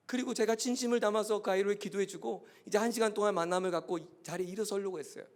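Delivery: ordinary talk, nothing marked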